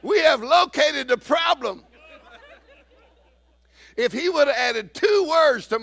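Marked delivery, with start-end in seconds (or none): 0.0-1.8 high, slow, loud, laughing announcement; laughing proclamation. Acted. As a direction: proclaim it slowly through laughter. Preacher's punchline.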